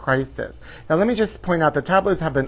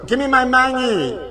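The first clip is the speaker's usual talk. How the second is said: high shouted picket chant; screaming for my money at the strike; mock strike scream; 9 semitones higher than usual. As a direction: yell it like a striker at a picket line. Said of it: "I'm gonna scream out".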